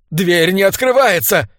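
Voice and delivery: high, alarmed exclamation; frightened cry. A narrator's reading. Trapped Sir Andrew finds the door stuck, crying out in fear. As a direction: call out in panic. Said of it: "испуганно выкрикнул он".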